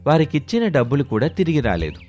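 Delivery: ordinary delivery, nothing marked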